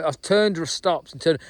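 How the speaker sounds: ordinary talk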